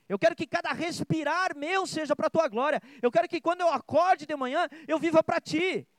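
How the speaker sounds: high, loud, impassioned plea to God; fervent preached prayer. Acted. Preacher praying a vow to live for God, fervent. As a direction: build into a ringing vow, pitched well up.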